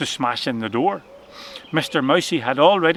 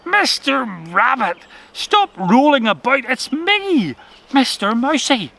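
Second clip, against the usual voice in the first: mouse's voice; 7 semitones higher, slower than usual. A narrator's reading. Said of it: "a familiar voice said".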